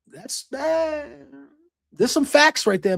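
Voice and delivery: a fan cheering a promo line; excited shout. Real, not acted. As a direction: blurt out that these are facts. Reacting to the promo.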